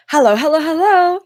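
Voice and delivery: high, loud, sing-song hellos; excited greeting. Retold or imitated. Emily answering the door for Friday dinner, gushing. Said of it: "answers the door in the most excited way".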